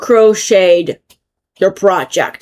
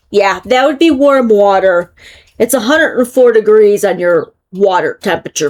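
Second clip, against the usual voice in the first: ordinary talk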